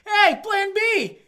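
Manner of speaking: hammed-up drunk heckler taunting; jeering drunk crowd. Bar crowd taunting the woman after the show, loud mock jeer.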